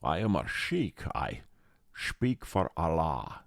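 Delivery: solemn mock Scottish sheik; Sean Connery. Scottish actor declaring himself a Moroccan sheik, in a Scottish accent. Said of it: "as Sean puts it in the movie".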